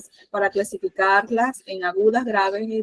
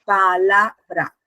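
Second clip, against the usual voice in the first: PALABRA slowly split as pa-la-bra; slow pa... la... bra, each syllable separate; divided into syllables; much slower than usual. Shown as a demonstration. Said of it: "si la dividimos".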